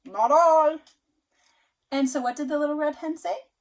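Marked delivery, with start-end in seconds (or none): 0.0-0.8 very loud gruff pig voice; pig refusing. Acted. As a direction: grunt out a blunt no. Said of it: "The pig said".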